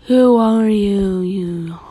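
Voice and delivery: long, wailing, slow spooky question; ghost boy. Acted. Ghost boy questioning the woman, slow and echoing. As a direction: draw it out and let it echo off. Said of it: "the ghost boy asked the woman".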